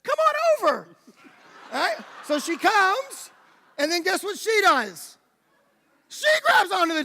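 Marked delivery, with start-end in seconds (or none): 0.0-0.9 slow, drawn-out call, pitched much higher with a laugh; calling over. Retold or imitated. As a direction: coax her across with a long bright call. Calling to scared girl on course.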